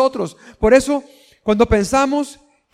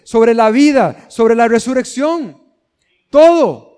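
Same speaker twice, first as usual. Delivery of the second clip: preaching at full force; 4 dB louder than usual. Acted. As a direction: proclaim Christ's power, rising to a cry.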